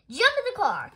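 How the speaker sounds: high, loud command; barked order. A narrator's reading. Mister Rogers hurries Amelia to her appointment, ordering loudly.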